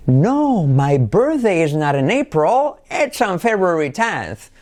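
a high, emphatic No, then a lively tune; expressive intonation. Shown as a demonstration. Teacher showing intonation on a sentence about a birthday. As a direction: punch the No, then let the tune ride up. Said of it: "Entonación".

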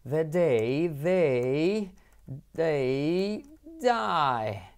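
the day they die stretched out very slowly; very slow twister. Shown as a demonstration. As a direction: drag each word of the day they die. (trabalenguas muy lento, palabra a palabra)

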